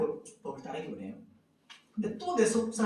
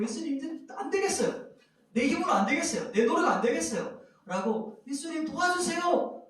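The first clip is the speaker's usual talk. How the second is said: despairing prayer; 9 semitones higher than usual. Acted. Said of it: "탄식하면서 으아 소리 지르고".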